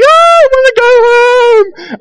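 very high, loud, slow wail; upset child whine. Retold or imitated. Frightened Johnny begging to go home, high slow wail. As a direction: wail it like a scared little kid who wants home. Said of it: "Johnny was like".

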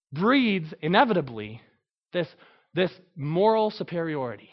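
ordinary delivery

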